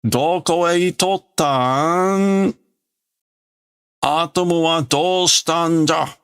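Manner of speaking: put-on high, slow, pressing old voice; a ruler demanding answers. Sultan demands where Pluto went.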